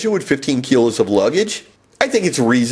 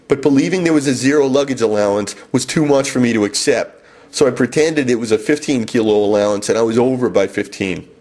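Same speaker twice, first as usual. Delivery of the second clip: ordinary talk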